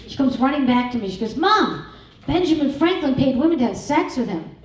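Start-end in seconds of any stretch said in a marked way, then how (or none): 1.4-4.5 excited daughter. Retold or imitated.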